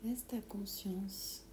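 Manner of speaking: ordinary talk, nothing marked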